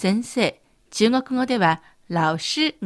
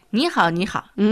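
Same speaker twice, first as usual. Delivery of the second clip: Beijing style; much faster than usual. Shown as a demonstration. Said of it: "繰り返すと北京っぽいなって思いますね".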